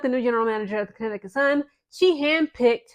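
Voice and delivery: normal talking voice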